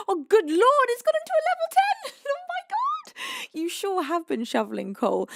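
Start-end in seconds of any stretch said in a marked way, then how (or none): 0.1-3.5 high, breathless laughing squeal; real giddy shriek of disbelief; overwhelmed squeal. Real, not acted. Overwhelmed by flood of gifts.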